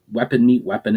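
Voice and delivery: ordinary delivery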